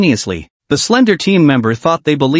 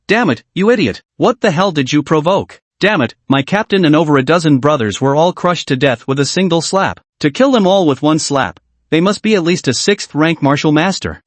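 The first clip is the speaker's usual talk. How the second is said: usual speaking voice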